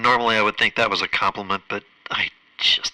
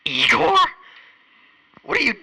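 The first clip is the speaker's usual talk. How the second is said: startled, much higher pitch; surprised. Acted. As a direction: squeak it out in disbelief. Surprised to see Igor.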